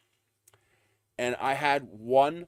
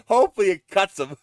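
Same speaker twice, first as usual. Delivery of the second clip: said through laughter; laughing; 5 semitones higher, 8 dB louder than usual. Real, not acted. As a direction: laugh while saying it. Laughing line.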